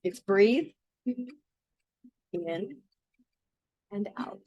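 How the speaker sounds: an upbeat leader explaining a group song; rally the group to join in; teaching a song. Acted. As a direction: warm song leader setting up.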